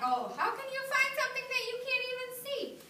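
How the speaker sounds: put-on high, wondering kid voice; a high, curious little voice; curious child voice. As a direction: ask like a puzzled child. Child's question voiced by teacher.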